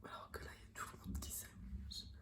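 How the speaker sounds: soft whisper in public. Real, not acted. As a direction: whisper so nobody hears. Whispers in the pharmacy.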